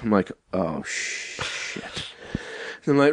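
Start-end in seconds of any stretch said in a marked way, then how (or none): 0.5-2.1 hushed, drawn-out curse; low, voiceless sigh of dread; retold dread. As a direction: let it out under your breath, slowly. Retold dread at having to catch up.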